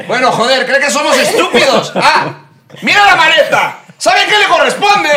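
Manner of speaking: high, loud hammed-up Spanish customs officer; angry Spanish officer. Mexican imitates the Spanish airport show officer, hammed-up angry shouting. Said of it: "ves el de España".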